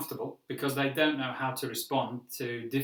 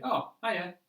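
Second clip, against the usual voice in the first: casual reply; 6 semitones higher than usual. Shown as a demonstration. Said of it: "the natural response to that would be".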